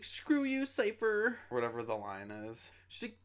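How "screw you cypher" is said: much higher, louder whiny yell; whiny shriek. Retold or imitated. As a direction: shriek it at the traitor, annoyed and whiny. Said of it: "And she's like".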